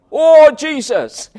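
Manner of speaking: high, loud, slow "Oh Jesus"; mock exclamation. Thomas's wrong reaction, mocked as a high loud exclamation. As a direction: exclaim it in a hammed-up way. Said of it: "didn't say".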